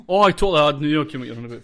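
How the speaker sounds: loud, fast burst; loud sudden realisation. Real, not acted. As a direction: blurt it out all at once.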